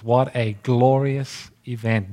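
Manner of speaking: ordinary talk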